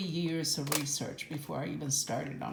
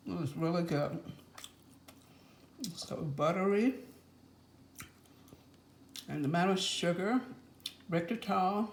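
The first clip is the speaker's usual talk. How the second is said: chewing while talking; mouth full. Real, not acted. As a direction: talk through a mouthful of cookie. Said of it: "I'm talking with my mouth full of cookies".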